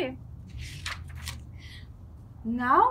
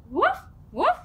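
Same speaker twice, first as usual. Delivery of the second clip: puppy bark; 6 semitones higher, 3 dB louder than usual. Pretend. Voicing the dog Biscuit.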